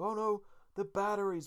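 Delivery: high, loud imitated cartoon alarm; mock panic. Co-host retelling Homer fumbling the remote batteries, mock panicked cry. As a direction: cry out in hammed-up dismay. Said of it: "they managed to panic".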